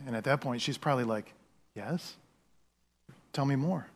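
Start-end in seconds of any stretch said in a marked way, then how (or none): 1.8-3.9 hushed Eve. Retold or imitated. Eve tempted, eager and hushed.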